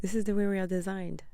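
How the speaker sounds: tossed out brightly right after the laugh; bubbly fast restatement; excited repeat. Real, not acted.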